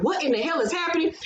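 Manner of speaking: retold bewilderment, rushed; uncomfortable, unsure. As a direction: imitate someone thrown off. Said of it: "he looked very uncomfortable and unsure".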